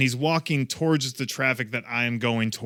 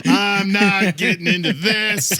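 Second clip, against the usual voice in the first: mock wary refusal; 4 semitones higher, 4 dB louder, slower than usual. Retold bystander refusing involvement.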